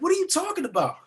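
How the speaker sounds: high, baffled disbelief. Retold or imitated. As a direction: throw it up high, confused. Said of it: "I'm like".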